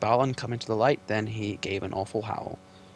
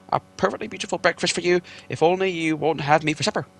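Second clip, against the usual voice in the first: higher, louder breathless begging hobbit; gasping plea; 7 semitones higher, 7 dB louder than usual. Acted. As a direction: beg the trolls while gasping for air.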